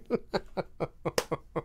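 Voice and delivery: soft trailing chuckle; real laugh, dying away. Real, not acted. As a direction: wheeze out a quiet laugh. Laughs at his own story.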